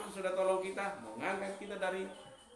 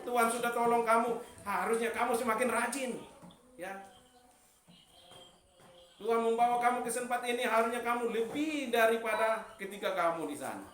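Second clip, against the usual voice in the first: rising, louder sermon voice; preaching; 6 semitones higher, 7 dB louder than usual. Acted. Preacher urging the church to give thanks, loud and rising. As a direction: preach it out to the congregation.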